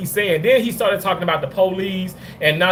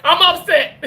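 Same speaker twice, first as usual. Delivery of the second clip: laughing fake anger; 7 semitones higher than usual. Real, not acted. Laughing while claiming anger.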